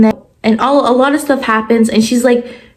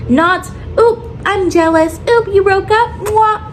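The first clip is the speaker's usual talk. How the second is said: higher-pitched mocking recap of a romance; mock jealousy; 9 semitones higher than usual. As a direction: mimic a silly, rushed movie romance. Mocks the film's rushed romance.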